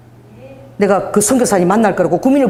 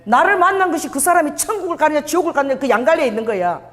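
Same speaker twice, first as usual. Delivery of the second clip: ordinary talk, nothing marked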